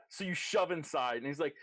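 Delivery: ordinary talk, nothing marked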